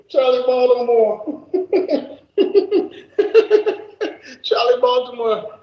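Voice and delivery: saying the name through laughter; cracking up on the name; laughing. Real, not acted.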